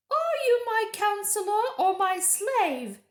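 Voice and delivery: high, loud, imperious witch voice; haughty witch. A narrator's reading. Witch rebuking the dwarf, high, loud and imperious. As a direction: put the servant in his place. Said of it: "said the witch".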